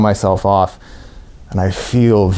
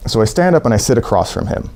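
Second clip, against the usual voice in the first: usual speaking voice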